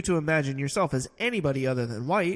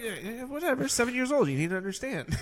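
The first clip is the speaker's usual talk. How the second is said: put-on higher, bored kid brushing it off; dismissive kid voice; 8 semitones higher than usual. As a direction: play a bored seven-year-old. Mimicking bored young Helena.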